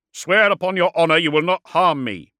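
high, loud warlord voice; calling out a demand. A narrator's reading. Warlord demands an oath from the dreadnought, calling out. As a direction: demand it at full projection.

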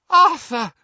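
very high, loud sobbing cry; wife sobbing with relief. Acted. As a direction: cry out his name through tears. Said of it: "sobbed Missus Weasley".

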